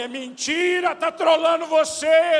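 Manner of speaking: high, loud, slow yell; father shouting to his son; imagined shout. Retold or imitated. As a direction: shout a warning, drawn out. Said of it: "Eu quero gritar pra ele".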